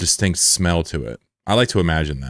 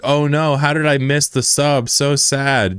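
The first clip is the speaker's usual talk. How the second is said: mock sad; 7 semitones higher, slower than usual. Shown as a demonstration. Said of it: "Do what everybody else does".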